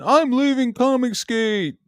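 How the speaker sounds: very high, slow sarcastic falsetto; mocking whiny announcement. Mocking the idea he is quitting Comicsgate, high slow parody. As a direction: parody a dramatic farewell, drawn out. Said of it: "this isn't a fucking".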